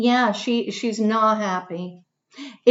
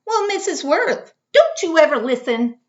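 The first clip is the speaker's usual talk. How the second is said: put-on high, loud exasperated child; a fed-up girl scolding a classmate; loud, exasperated child's scold; reading a yell; 6 semitones higher, 5 dB louder than usual. A narrator's reading. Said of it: "She's yelling at him".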